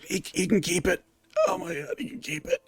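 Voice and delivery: high, soft, tearful whimper; whimpering injured man. Acted. Injured man giving up his eye, whimpering. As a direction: whimper it quietly, pitch climbing sharply. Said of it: "He goes".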